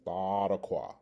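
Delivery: Thadakwa with a TH; alternative pronunciation. Shown as a demonstration. Teacher repeats the alternative form, careful demo of Thadakwa with TH. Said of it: "the alternative pronunciation is".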